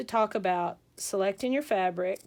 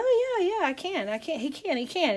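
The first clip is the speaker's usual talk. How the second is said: eager, higher-pitched insisting; acting out a dog owner protesting he can; hurried, animated mock insistence; insistent dog owner; 8 semitones higher than usual. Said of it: "And he goes".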